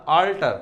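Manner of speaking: short clipped આલ્ટર, a not o; teacher's pronunciation. Shown as a demonstration. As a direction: repeat આલ્ટર briefly with an open a. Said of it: "ઓલ્ટર નથી બોલતા". (આલ્ટર ટૂંકું, આ સાથે)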